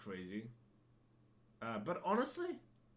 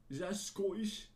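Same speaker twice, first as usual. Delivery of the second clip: higher, unsure Scottish accent; attempted Scottish accent; 6 semitones higher than usual. Shown as a demonstration. Podcaster checking his own impression, in an attempted Scottish accent. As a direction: try out a shaky grasp of a Scottish accent. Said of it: "I'm not the best at doing, like, Scottish".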